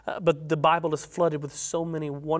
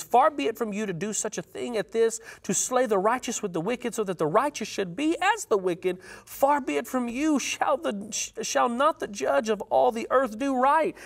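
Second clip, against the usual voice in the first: urgent plea; 6 semitones higher than usual. Acted. Abraham bargaining with the Lord.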